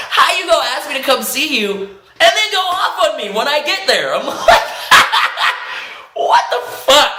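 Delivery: high, laughing outrage; exasperated laughing rant; laughing indignation. Retold or imitated. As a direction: rant it back, cracking up. Retold angry quote while laughing.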